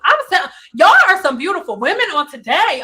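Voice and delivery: loud, thrilled hello to the viewers; gushing happy welcome; excited greeting. Real, not acted. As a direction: burst out with delight at who just joined. Greeting viewers joining live.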